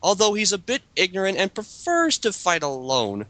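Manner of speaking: ordinary delivery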